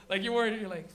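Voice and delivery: ordinary talk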